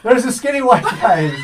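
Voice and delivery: high, loud, laughing gossip voice; mock gossiping listener. Joking about a listener's crush in a high gossiping voice. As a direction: play a giddy listener spreading news. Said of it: "Honey, did you hear?".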